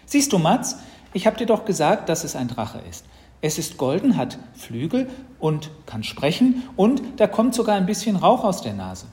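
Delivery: high, loud, excited listing; triumphant little sister. Acted. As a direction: prove your point like a know-it-all child. Sister triumphantly proves it's a dragon.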